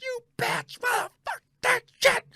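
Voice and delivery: high, strained angry yell. Retold or imitated. Fan raging at the TV, high pitched yell. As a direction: rage at the TV, high. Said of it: "the way I'm yelling".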